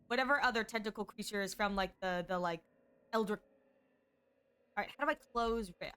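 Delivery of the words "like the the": ordinary delivery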